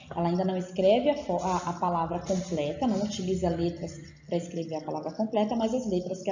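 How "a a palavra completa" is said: usual speaking voice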